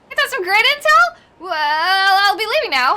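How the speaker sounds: excited Kano. Acted. Voicing cheerful Kano character.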